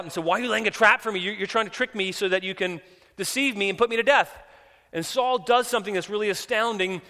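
loud, high, urgent retelling; full-force preaching. Real, not acted. Preacher voicing the woman who fears a trap, full-force preaching. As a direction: preach it loud and high, racing through the accusation.

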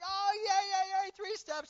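very high, loud celebration; hammed-up overjoyed parent shouting; ecstatic cheering. Parent thrilled at baby's first three steps, ecstatic cheering.

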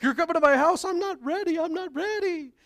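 high, flustered host voice; flustered mock panic. A flustered host hearing Jesus is coming over, high pitched. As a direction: squeak out the panic. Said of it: "we'd be like".

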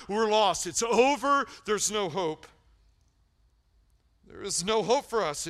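fired-up sermon peak; full-force preaching. Real, not acted. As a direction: hammer it home loudly.

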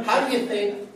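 ordinary talk

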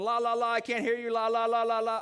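put-on loud, high, sing-song kids plugging their ears; mock the leaders like little kids; childish la la la. Religious leaders covering their ears, mocking, loud and high. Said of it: "It's kind of like they're going".

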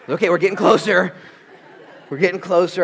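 high, very loud strained dad voice; hammed-up losing patience with the kids; off tone, exasperated. Exasperated dad coaching his son's apology, loud and strained. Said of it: "My tone was off by this time".

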